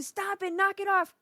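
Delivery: a hint of a fed-up parent screaming; scolding the kids, a lightly voiced mock shout; imitating yelling at kids. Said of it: "Screaming and screaming".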